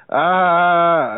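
a short, high, loud "Ah"; imitated angry yell. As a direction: bark out a sudden mock yell. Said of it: "And he was like".

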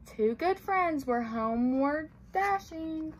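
usual speaking voice

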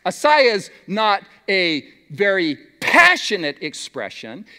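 ordinary delivery

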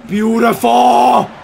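slow, loud stretched-out praise; drawn-out cheer. Real, not acted. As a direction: stretch it out in a big shout. Celebrating a goal on stream.

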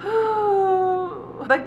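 a heavy sigh, voice high and cracking; sigh of despair. Real, not acted. Sighing in despair over Dimitri's fate. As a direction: let it break on a sigh.

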